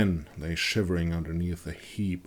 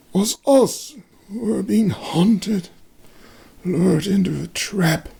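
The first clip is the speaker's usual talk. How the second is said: much higher feverish fearful voice; haunted wounded man; 13 semitones higher, 5 dB louder, slower than usual. A narrator's reading. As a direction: remember it with dread. Wounded man tells attack.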